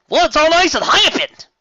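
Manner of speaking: high, loud bewildered ask; alarmed question. Acted. Applejack back home, loud bewildered question. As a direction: demand what's going on.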